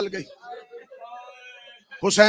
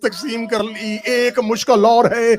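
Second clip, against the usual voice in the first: slow, rising to loud wailing; preaching at full force; 2 semitones higher, 12 dB louder than usual. Acted. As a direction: drag each phrase, then cry out. Slow build to shouted lament. (آہستہ شروع، پھر بلند بین)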